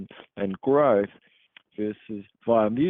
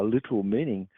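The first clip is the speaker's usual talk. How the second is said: ordinary talk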